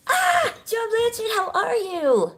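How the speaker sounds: high, drawn-out cry of a name; excited greeting. Real, not acted. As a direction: call out happily to a friend. Greeting viewer in live chat.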